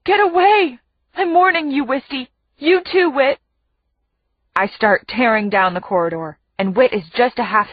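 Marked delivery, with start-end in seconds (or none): 0.0-3.4 high-pitched, urgent plea; desperate warning. A narrator's reading. Captive mother warning both children away, desperate. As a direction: warn them off, pleading.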